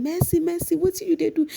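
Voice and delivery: very high called name; mock calling out. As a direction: call her name across the house in imitation. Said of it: "they started shouting her name".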